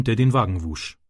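ordinary delivery, nothing marked

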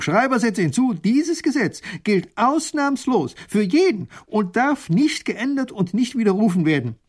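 high, loud, emphatic decree; irate ruler's forceful proclamation; scolding. A narrator's reading. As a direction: dictate furiously, hammering key words. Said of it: "schimpft der Großherzog".

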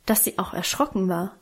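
usual speaking voice

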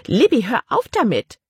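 high, sharp order; commanding. A narrator's reading. Aunt orders her hiccuping niece to stop, commanding. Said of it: "befahl ihre Tante".

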